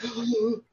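sharp shocked intake of breath; gasp. Retold or imitated. Viewer reacting to the film's ending, gasping. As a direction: gasp as the scene cuts off. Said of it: "and you're like".

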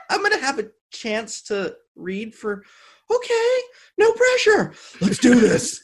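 high, giddy squeal; excited. Retold or imitated. Retold thrill at the audition.

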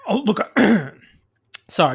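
hoarse, clearing throat; rough throat, mid-cough; frog in throat. Real, not acted. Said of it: "I got a frog in my throat".

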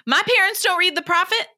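high, loud defiant quote; defiant Hermione. Retold or imitated. Hermione brushing off the warning about Rita, high defiant voice. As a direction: toss it off like a defiant teen. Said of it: "And she's like".

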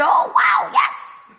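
put-on high-pitched, eager child voice; eager little girl. Six-year-old self answers mom, high and eager. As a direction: play the over-keen little girl.

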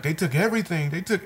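crying, broken English. Retold or imitated. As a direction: lightly imitate a crying woman's broken English. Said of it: "she's speaking broken English".

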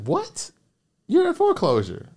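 high-pitched mock disbelief; incredulous reaction. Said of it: "thought I was crazy".

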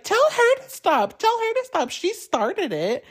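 very high-pitched mock kid voice; childish tantrum voice. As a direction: imitate a grown woman arguing like a child. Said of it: "she sounds like a child".

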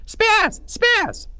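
very high shrill yell; high yelling taunt. Acted. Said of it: "still yelling".

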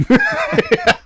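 a high, far-off cackle to open the show; hammed-up laugh. Host kicks off the show with a put-on cackle.

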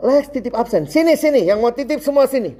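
high, eager calls across a classroom; calling out. Retold or imitated. Imitates classmates and himself calling out.

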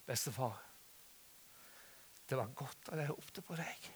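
imitated sleepy-soft grandchild's confiding; hushed retold child's words; soft, tender. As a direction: say it quietly, close up to Grandpa. Grandchild's tender words after hug.

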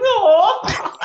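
high, loud, drawn-out "aww"; touched cooing. Acted. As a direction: coo as if melting at something sweet. Cooing at a sweet embrace.

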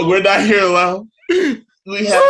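loud laughing repeat; laughing shout. Real, not acted. Host repeats line, laughing loudly.

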